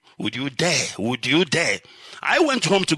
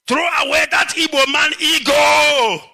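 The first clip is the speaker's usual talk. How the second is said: fierce shout; 9 semitones higher, 6 dB louder than usual. Real, not acted. Urging Biafrans to drop their ego, shouting. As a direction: shout it out, higher and much louder. Rallying crowd against ego.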